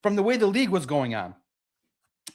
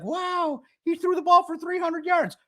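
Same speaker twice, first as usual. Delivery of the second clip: high, mock-amazed fan voice, much higher than his own; mock fan awe; 10 semitones higher than usual. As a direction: play the dazzled fan who only sees yards. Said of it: "And everybody's like".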